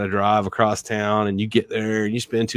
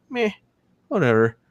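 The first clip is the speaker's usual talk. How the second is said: imitated apathetic shrug of a reply; mock indifference; 7 semitones higher than usual. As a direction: act like you couldn't care less. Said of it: "If you're not emotional about it".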